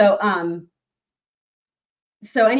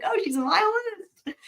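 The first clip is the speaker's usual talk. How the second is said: mock surprise; 11 semitones higher than usual. Voicing the lawyer's discovery.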